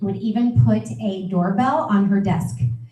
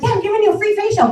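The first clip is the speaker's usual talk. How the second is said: much higher and fast, bubbling with excitement; excited; 10 semitones higher, much faster than usual. Shown as a demonstration. Trainer showing consultants the excited booking voice. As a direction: sell the free facial like it's the best news ever. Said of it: "You're excited".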